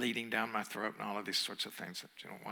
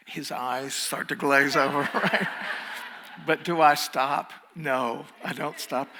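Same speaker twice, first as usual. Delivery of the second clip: laughing through it, louder; 3 semitones higher, 9 dB louder than usual. Real, not acted. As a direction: chuckle at your own stubbornness. Laughing at his own persistence.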